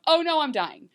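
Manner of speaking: mock-panicked death announcement; sudden mock alarm. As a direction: break off in abrupt, hammed-up dismay. Abrupt switch to dying mother.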